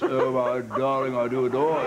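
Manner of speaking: lightly hammed-up plummy English endearment with a laugh; a hint of a swooning upper-class Englishman; mock posh English lover. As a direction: play the smitten English gent, half laughing. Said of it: "be sexy when you're talking like this".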